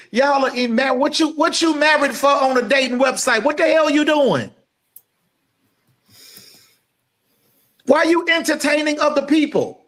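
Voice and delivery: high, rising indignant preaching; fired-up rebuke. Real, not acted. Preacher calling out married people on dating sites, rising outrage. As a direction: call them out, pitch climbing.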